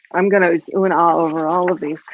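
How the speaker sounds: usual speaking voice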